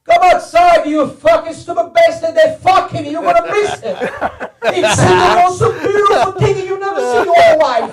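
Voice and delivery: put-on high, shouted Italian-accented scolding; excited Italian dad yelling to come see; Italian father's accent. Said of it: "most fucked up Italian accent".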